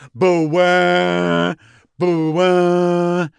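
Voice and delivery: loud imitated war horn, drawn out; mock horn blast. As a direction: blow it like a barbarian horn. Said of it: "they start to blow those fucking horns".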